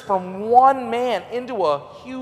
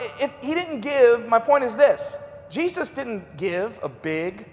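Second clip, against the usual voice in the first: usual speaking voice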